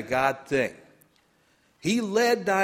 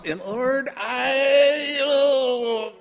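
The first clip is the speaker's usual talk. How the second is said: higher, louder anguished "Lord, I, oh"; mock distress; 9 semitones higher, 5 dB louder, much slower than usual. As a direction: ham up the despair. Retold panic at God.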